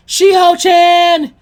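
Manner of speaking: drawn-out, high, very loud call; shouting a name. Acted. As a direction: yell her name across the library. Naruto yelling in library.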